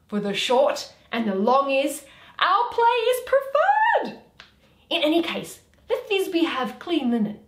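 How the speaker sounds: loud swaggering Bottom. Acted. Bottom teasing the players with his tale, loud. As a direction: boast to the players loudly.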